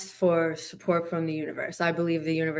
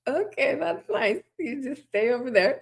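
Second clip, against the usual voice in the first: nervous wariness; 8 semitones higher than usual. Retold or imitated. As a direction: keep the hen at bay with a wary singsong. Bird-phobic talking to hen.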